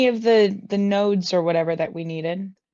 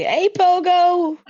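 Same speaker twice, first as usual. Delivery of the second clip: high, loud, slow call; 10 semitones higher, 6 dB louder, slower than usual. Acted. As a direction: hail a friend from afar. Calling out to a dragon NPC.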